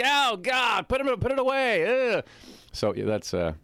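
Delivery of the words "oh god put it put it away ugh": high, loud horrified recoil; hammed-up revulsion ending in a groan; mock disgusted shout. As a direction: imitate grossed-out brothers yelling to make it stop. Brothers recoiling, acted out.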